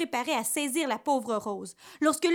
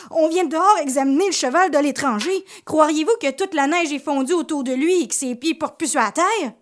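alarmed report; 5 semitones higher, 7 dB louder than usual. Acted. Horse dealers rush in alarmed.

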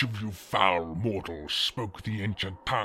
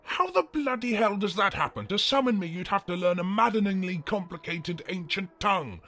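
higher-pitched, indignant demon rant; exasperated outrage; 5 semitones higher, faster than usual. Acted. Demon learning a burp summoned him, exasperated indignant rant. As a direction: lose all grandeur in an incredulous tirade.

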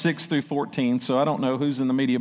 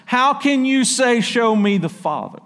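higher, louder, slow and heavy; weighty words; 9 semitones higher, 5 dB louder than usual. Shown as a demonstration. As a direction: let each word land with weight. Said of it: "Listen to the weight of his words".

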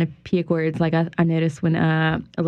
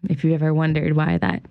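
ordinary talk

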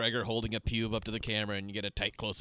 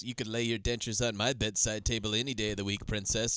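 ordinary talk

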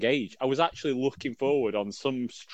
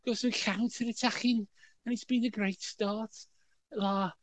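dour Scouse; 11 semitones higher than usual. Retold or imitated. Host mimics Duffy commentating on the match, mock dour Scouse. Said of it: "I can't even do Scouse very well".

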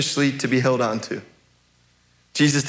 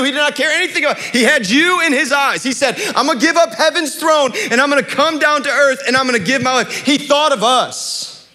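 raised, emphatic sermon voice; impassioned preaching; 8 semitones higher, 3 dB louder than usual. Real, not acted. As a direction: preach it with urgency, higher than your talk. Preacher pressing the point home.

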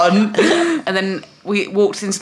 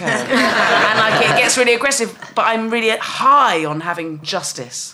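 normal talking voice

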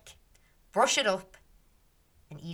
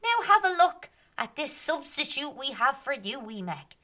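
mocking taunt; 6 semitones higher, 4 dB louder than usual. Fairies taunting Meg.

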